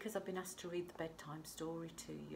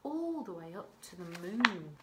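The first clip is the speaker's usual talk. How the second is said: ordinary delivery, nothing marked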